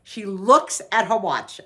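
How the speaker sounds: normal talking voice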